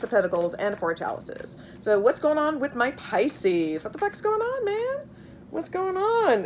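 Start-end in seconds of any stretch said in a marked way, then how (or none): 3.8-6.4 pitched up high in baffled disbelief; what on earth is this, thrown up high; exasperated disbelief. Real, not acted. Reader baffled by the cards asks them, high and exasperated.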